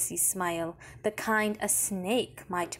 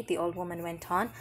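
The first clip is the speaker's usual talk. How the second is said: plain reading voice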